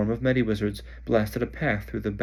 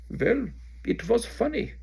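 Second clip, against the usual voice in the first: sly minister; 13 semitones higher, slower than usual. Acted. Bulgarian minister shrugging off Fudge, amused. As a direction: tease him with a shrug.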